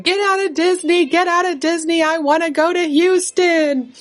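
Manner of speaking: put-on high, whiny tantrum voice of a little girl; mock crying child. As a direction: wail it like a four-year-old in a tantrum. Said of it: "she's crying".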